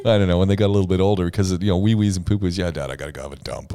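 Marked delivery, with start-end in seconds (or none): 2.6-3.7 put-on low, quick, offhand kid's announcement; lightly voiced son. Dad quoting his boy announcing a toilet trip, lightly voiced son. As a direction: toss it off low and soft, imitating a son.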